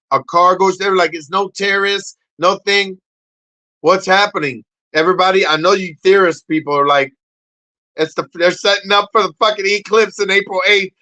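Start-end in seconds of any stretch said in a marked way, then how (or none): none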